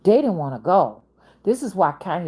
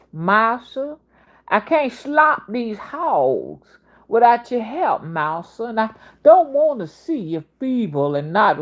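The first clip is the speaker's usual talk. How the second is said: slow, high, drawn-out southern servant voice; imitating southern drawl; 3 semitones higher, 4 dB louder, slower than usual. Narrator imitates an enslaved man begging his master, slow southern drawl. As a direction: drawl it out slowly like a devoted old servant pleading with his master. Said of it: "I'm just only trying to narrate how they talk".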